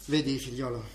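soft, low, tired voice; weary and solemn. Acted. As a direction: confess the truth wearily. Weary, solemn confession to son.

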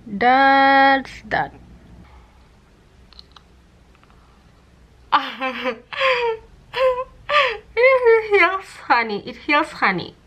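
laughing with mouth stretched open; lips held open by retractor. Real, not acted. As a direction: cannot close lips. Seen 2: Plastic cheek retractor holds lips wide open throughout, hands holding it.

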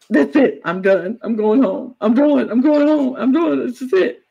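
mock fed-up outburst, pitched high; Brittany. Acts Brittany giving up, leaving.

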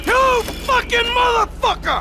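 a betrayed husband screaming at his wife's lover; furious shout. Acted. Enraged husband before wrecking house.

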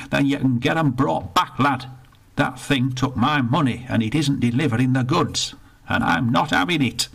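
put-on indignant old northerner, rushed in the middle; grumpy old Bertie. Bertie complains to the attendant, indignant grumble. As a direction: grumble about the lost pound.

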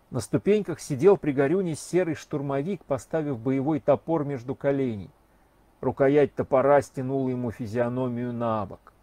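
ordinary delivery

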